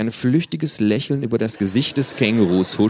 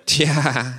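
meaningful; 7 semitones higher, 4 dB louder than usual. Acted. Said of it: "sagt das Känguru vielsagend".

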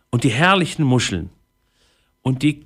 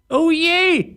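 high-pitched wail of dismay; sighing pirate. A narrator's reading. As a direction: lament it in a much higher voice. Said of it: "seufzte Einauge". (seufzender Pirat, sehr hoch)

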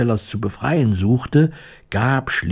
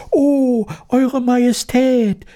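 very high, drawn-out mouse squeak; excited squeaking; slower than usual. A narrator's reading. Breathless mice greet their queen, squeaking in a very high voice. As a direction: squeak like a flustered little mouse. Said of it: "piepsten sie durcheinander".

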